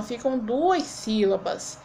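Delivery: ordinary talk, nothing marked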